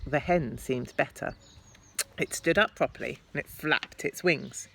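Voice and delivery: ordinary delivery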